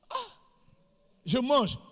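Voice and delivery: ordinary talk, nothing marked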